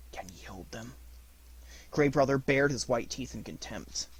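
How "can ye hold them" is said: quiet. Acted. Mowgli asks the wolf.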